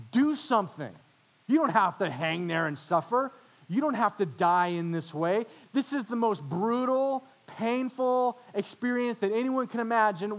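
loud, high, jeering taunts at the man on the cross; mocking insults. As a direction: sneer and hurl every line. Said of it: "hurled insults at Jesus".

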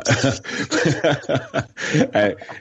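a short real laugh at his own joke; amused laugh. Real, not acted. Guest laughing at his own quip about his father, real amused laugh. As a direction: chuckle at the punchline about dad.

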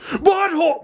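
very high cried-out name; war cry. Acted. Fighter finishing the war cry with leader's name, high cry. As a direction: cry the name as a battle call.

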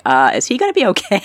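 high, laughing worried question; worried surprise. Retold or imitated. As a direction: ask it half-laughing, alarmed. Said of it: "and I'm like".

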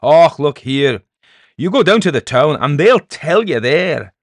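loud, high Scots protest; indignant Scottish peddler. Acted. Peddler insisting the townsfolk know, indignant. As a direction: insist at full voice, Scots accent.

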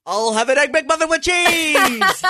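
a big TV announcer voice at the drive-through; announcer-style order. Pretend. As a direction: boom the order like introducing a star. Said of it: "imagine this McDonald's order".

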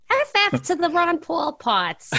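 put-on high-pitched, accented mom voice; imitation of mother. Mother wanting the debate fast-forwarded, high mimicry. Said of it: "My mother used to call him".

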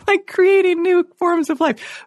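laughing through the words, pitch jumping high; barely holding in a giggle; cracking up. Retold or imitated. Telling the host about her dinner neighbour, laughing high. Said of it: "inside I was just cracking up".

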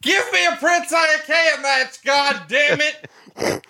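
high, hammed-up shouting demand; mock shout. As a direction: yell it like a fan demanding a match, drawn out.

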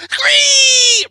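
high screeching dragon cry. Retold or imitated. Dragon flies past, high screeching cry. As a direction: let out a shrill monster screech. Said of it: "the dragon ... is like".

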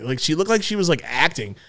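ordinary talk

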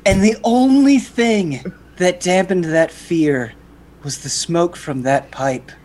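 normal talking voice

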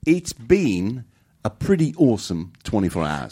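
slow, careful Kiwi accent; New Zealand accent. Shown as a demonstration. Cohost copies Jordan from New Zealand, careful Kiwi accent. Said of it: "a New Zealand accent with me".

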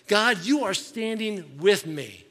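proclaimed loud and slow, each word weighed; loud slow proclamation. Retold or imitated. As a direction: declare it to God with full confidence. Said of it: "he's like".

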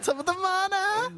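very high, drawn-out Irish caricature; mock Irish accent. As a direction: stretch it out in a squeaky Irish brogue. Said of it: "stereotypical stuff".

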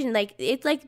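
usual speaking voice

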